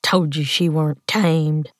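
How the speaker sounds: spat with understated contempt; a touch of scorn. A narrator's reading. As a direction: spit the words out at a partner. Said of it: "he spat".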